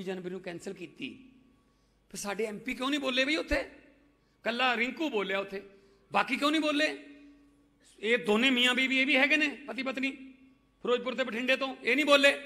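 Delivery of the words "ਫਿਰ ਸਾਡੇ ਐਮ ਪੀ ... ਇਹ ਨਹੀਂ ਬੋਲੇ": higher-pitched indignant questioning, rising in force; indignant challenge. Real, not acted. Politician asking why the MPs kept silent, indignant and high. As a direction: demand answers, pitch pushed up.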